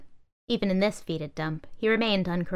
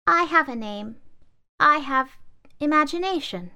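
high, louder doll voice; doll insisting; 9 semitones higher, 5 dB louder than usual. Acted. Doll answering the laptop, high and louder. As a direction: stand up for herself.

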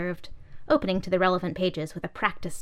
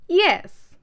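much higher, loud yes; conceding; 12 semitones higher, 8 dB louder than usual. Acted. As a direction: manifesto conceding to the umbrella, high and loud. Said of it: "conceded Manifesto".

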